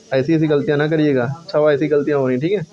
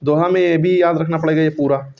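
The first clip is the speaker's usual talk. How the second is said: usual speaking voice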